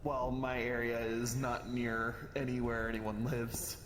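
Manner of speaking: ordinary talk, nothing marked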